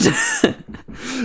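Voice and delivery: very high squealing laugh. Real, not acted. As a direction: shriek with laughter. Laughing at the scam.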